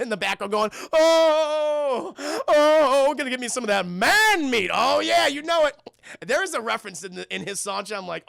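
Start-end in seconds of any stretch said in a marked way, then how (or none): 0.9-5.8 big, loud, high whooping villain; swaggering boogeyman, relishing every oh; hammed-up hungry monster crooning; Oogie Boogie.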